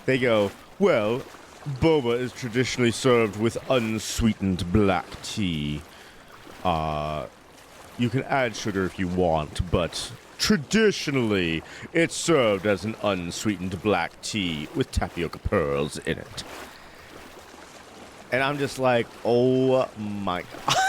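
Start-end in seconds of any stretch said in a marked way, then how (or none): 0.8-16.4 slow, lightly mocking snooty clerk; pretentious clerk. Shop clerk lecturing customers on tradition, mock snooty. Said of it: "the most pretentious shit I'd ever heard". 19.2-20.8 slow, laughing disbelief; exasperated laugh. Retold or imitated. Nick reacting to the snobby clerk, slow laughing disbelief.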